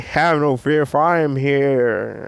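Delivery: lightly voiced superhero entrance; heroic announcement. All Might arriving to save the kid, a hint of heroic proclamation. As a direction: stretch the last words out grandly.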